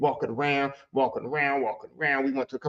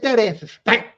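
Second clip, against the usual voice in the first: ordinary delivery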